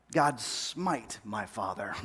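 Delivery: low, slow mock prayer; a touch of righteous anger, drawn out; mock angry prayer. Son praying against his father, low slow mock anger. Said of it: "it was an angry prayer".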